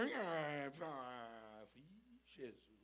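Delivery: low, drawn-out groans of a waking beggar; groggy homeless man groaning in the morning. Retold or imitated. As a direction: moan like a tramp disturbed at dawn. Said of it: "E começou".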